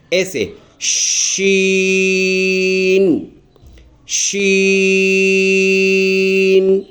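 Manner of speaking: شین held long, one alif, hissing sh; drawn-out Sheen. Shown as a demonstration. Teacher demonstrating the letter for young kids: long drawn شین. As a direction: stretch شین slowly, like a hand opening. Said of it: "وہ ایسے کہ بند انگلی کو آرام آرام سے کھولیں گے".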